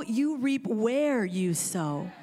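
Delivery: ordinary delivery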